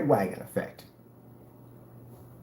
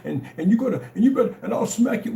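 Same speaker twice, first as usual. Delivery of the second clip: mock threat; 8 semitones higher, faster than usual. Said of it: "talking about".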